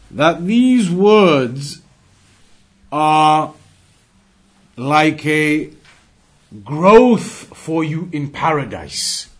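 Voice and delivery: loud, slow, weighty delivery; emphatic preaching. Real, not acted. Preacher stressing a virtue to his audience, loud and slow. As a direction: drive each phrase home to the room.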